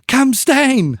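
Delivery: high-pitched imitated child's cheer; little boy yelling from the stands; excited kid cheering. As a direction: call out to pump up the player. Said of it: "this kid who was so excited".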